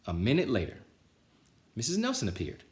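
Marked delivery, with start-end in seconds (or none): none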